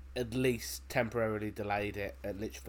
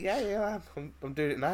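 high, hurried comic assurance; mock casual reply; 8 semitones higher than usual. Player answers while wetting himself, mock nonchalant. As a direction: brush off the question in a raised voice.

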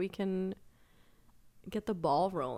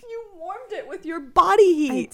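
laughing; 10 semitones higher, 7 dB louder than usual. Real, not acted. Disgusted laughing reaction.

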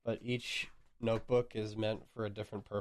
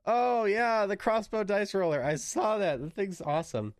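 high, loud excited recognition; light up, high and loud; 9 semitones higher, 4 dB louder than usual. Real, not acted. Seeing the crossbow dice roller.

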